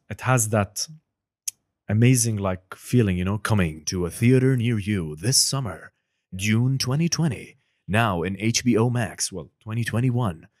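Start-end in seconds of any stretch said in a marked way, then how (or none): 3.4-9.3 slow, loud epic trailer announcer; movie trailer voice. Shown as a demonstration. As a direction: sell the summer blockbuster, slowly. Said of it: "the movie trailer voice".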